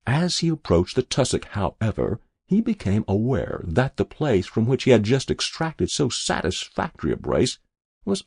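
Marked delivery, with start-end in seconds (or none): none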